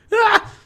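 short, high mock scream; sudden loud cry of fright; startled yelp. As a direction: yelp as if caught by surprise. Mimics the startled reaction.

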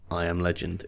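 flat, low and downbeat; quiet resigned delivery. Shown as a demonstration. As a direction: say it like defeat. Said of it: "in a very, very downbeat voice".

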